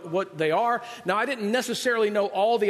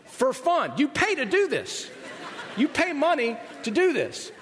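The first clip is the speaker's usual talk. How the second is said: high-pitched mock disbelief; drawn-out, high-pitched comic outrage; comic incredulity; 8 semitones higher, slower than usual. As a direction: marvel at the absurdity, voice pitched up. Comic disbelief at paying for shocks.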